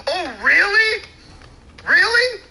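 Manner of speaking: high, loud mock disbelief; sarcastic disbelief. Real, not acted. Ranting host mocking the actor's claim, sarcastic disbelief.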